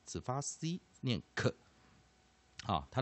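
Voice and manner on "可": 可 as a short isolated k sound; silent-H demo. Shown as a demonstration. Teacher explaining CH with silent H, short hard 可. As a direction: say just the C as a hard k, 可. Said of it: "C跟H，H不发音".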